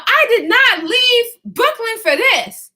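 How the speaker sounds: high, loud, slow mock yell; hammed-up indignant neighbor hollering. Neighbor yells down from balcony, high loud indignant mock yell. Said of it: "she yelling from her balcony".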